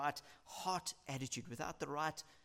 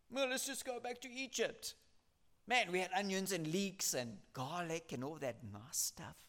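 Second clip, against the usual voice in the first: grumbling Israelites; 4 semitones higher than usual. Acted.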